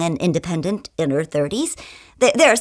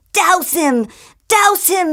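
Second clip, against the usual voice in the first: much higher, insistent inner voice; urging inner voice; 11 semitones higher, slower than usual. Acted. Kelly's inner voice urging her to throw the wine, high and insistent. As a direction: egg her on, high and urgent. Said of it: "her inner voice says".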